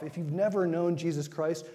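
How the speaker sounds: ordinary talk, nothing marked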